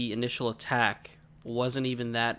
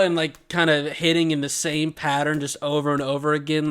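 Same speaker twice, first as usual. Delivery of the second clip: plain reading voice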